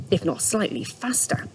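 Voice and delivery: usual speaking voice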